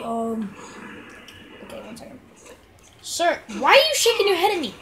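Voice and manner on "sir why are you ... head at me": indignant. Real, not acted. Addressing orc indignantly.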